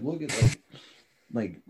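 usual speaking voice

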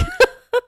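high burst of laughter; disbelieving laugh. Real, not acted. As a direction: laugh out in shock.

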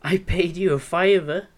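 mock outrage, demanding the food back; angry complaint. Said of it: "I go to the counter and say".

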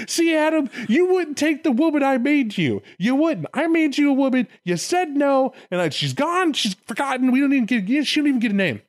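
high, exasperated mock tirade; hammed-up indignant telling-off; scolding God. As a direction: rail at the culprit, pitched well up. Acted God scolding and cursing Adam.

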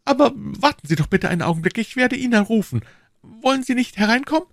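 hoch und schnell, höflich; junge Frau. A narrator's reading. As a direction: eilig und höflich bitten. Lizzie invites stranger in. (hohe, schnelle Frauenstimme)

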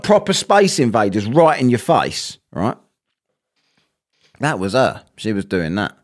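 ordinary talk

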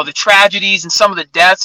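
normal talking voice

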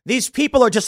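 ordinary delivery

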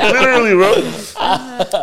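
slow, high and laughing. Real, not acted. Still laughing at the joke.

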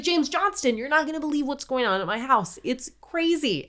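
high-pitched, breathless-to-tell quote; excited disbelief. Retold or imitated. John tells his friend about the haunting, excited disbelief. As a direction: blurt it high, then stretch the ending.

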